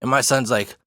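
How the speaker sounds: plain reading voice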